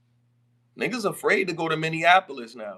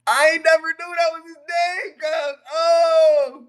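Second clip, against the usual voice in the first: very high, loud yell of disbelief; astonished shout; 13 semitones higher, 9 dB louder, slower than usual. Real, not acted. Streamer learning [name]'s real name from friend, astonished. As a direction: holler in amazement, ending on a big "Oh!".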